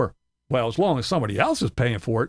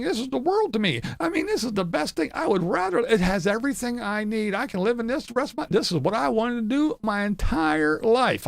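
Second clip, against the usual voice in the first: high, whiny, hammed-up mock voice; mocking Tim's brag about his RV, high whiny parody; 8 semitones higher than usual. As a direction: parody a smug guy gushing over his trailer. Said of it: "this is the guy who just not too long ago said".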